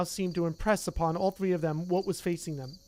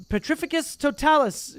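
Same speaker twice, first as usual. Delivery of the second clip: higher, louder spell cast; crying out a spell; 8 semitones higher, 6 dB louder than usual. Acted. Hermione casting at Neville, loud high incantation. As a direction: fire the spell at him. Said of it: "she cried".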